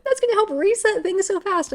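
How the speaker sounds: high, loud, gushing delight; gushing excitement. Retold or imitated. Admiring people who cry easily, high and loud with gushing delight. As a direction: rave about it like it's the best thing ever. Gushing envy over easy criers.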